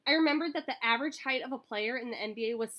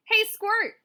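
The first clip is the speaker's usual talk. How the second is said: high, louder playful greeting; brotherly call; 12 semitones higher, 9 dB louder than usual. A narrator's reading. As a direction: tease little brother loudly.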